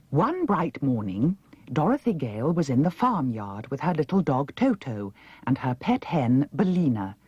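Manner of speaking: usual speaking voice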